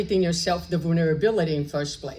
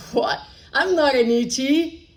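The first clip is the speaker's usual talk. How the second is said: put-on indignant child; 6 semitones higher, 5 dB louder than usual. Child protests being called ET.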